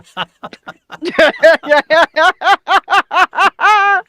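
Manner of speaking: high, loud theatrical evil cackle; villain laugh. Pretend. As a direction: cackle like a sea monster.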